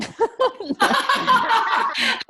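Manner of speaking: high, loud amused laugh; burst of laughter. Real, not acted. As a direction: laugh out loud at your own teasing. Put colleague on the spot.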